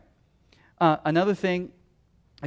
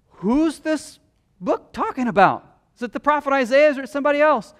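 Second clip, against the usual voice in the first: high, loud, eager question; curious eunuch; 9 semitones higher, 6 dB louder than usual. Retold or imitated. Eunuch asks Philip about the prophet, high and eager. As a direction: ask like an intrigued traveller. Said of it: "the eunuch says".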